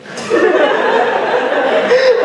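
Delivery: amused laugh after a joke about being hungry. Real, not acted. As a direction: laugh at the punchline.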